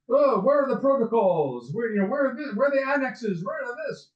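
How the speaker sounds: high-pitched, mock-outraged Russian accent; bad Russian accent. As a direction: demand it in a badly faked Russian accent. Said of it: "excuse my really bad Russian accent".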